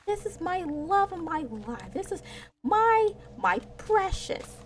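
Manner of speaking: high, cooing mock parent voice; doting parent. Parent doting on the doomed baby, high mock coo. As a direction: coo over the baby, hammed-up. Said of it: "the parent just like".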